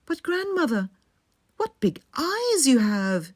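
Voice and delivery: rising alarm at what she sees; alarmed girl. A narrator's reading. Girl staring at the wolf, high and startled.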